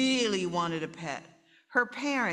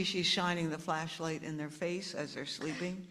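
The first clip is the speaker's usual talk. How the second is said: ordinary delivery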